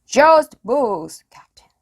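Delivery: high loud call down; shouted. A narrator's reading. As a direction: shout back to the captain. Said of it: "shouted Stinky".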